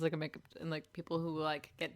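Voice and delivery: ordinary delivery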